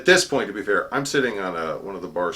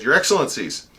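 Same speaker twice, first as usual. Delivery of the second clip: loud, raised formal proclamation; center-stage herald; 5 semitones higher, 7 dB louder than usual. Retold or imitated. Herald interrupting their Excellencies over protocol, loud and formal. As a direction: announce it to the whole hall. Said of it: "put myself center stage".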